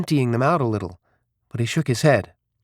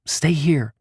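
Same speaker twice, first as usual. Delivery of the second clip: usual speaking voice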